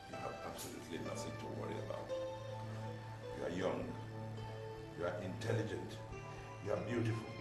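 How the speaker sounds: low, gentle consoling; tender reassurance. Acted. Father consoles his daughter with praise, tenderly. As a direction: comfort with soft, measured praise.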